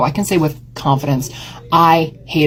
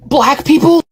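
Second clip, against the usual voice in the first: much higher, louder mock gasp of horror; mock shocked Portlander; 12 semitones higher, 4 dB louder, slower than usual. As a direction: mimic a clutching-pearls local, high and loud. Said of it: "people being like".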